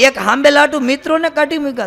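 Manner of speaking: high, outraged disbelief; a mock gasp of shock, pitched well up; shocked husband. Husband, shocked his wife drove friends off, cries out high in disbelief.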